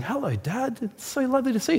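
high, soft, mock-angelic child voice; soft sing-song imitation of adoring kids; sweet imagined children. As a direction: greet Dad like an impossibly well-behaved child. Voices his imagined perfect children.

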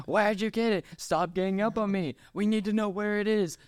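high, frantic imitation of a movie argument; overacted interrogation scene; mock quarrel. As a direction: badger and protest in a hammed-up way. Acts out film's frantic interrogation.

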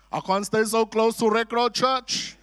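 loud, high, laughing through the words; laughing refusal. Retold or imitated. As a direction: laugh it off as a joke. Mock refusal to move house.

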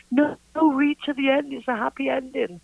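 high, insistent coaxing; urgent reassurance. Retold or imitated. Artist to a sobbing reader, urgent reassurance.

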